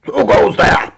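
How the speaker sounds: high, loud challenge; guards shouting. Acted. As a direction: bark out like a startled guard. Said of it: "the guards shouted".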